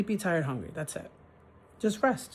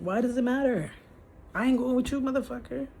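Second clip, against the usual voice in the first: higher, defiant comeback; sassy retort; 7 semitones higher than usual. Retold or imitated. Telling the prying super off, high mocking retort. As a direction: snap back at the nosy super, voice pitched up.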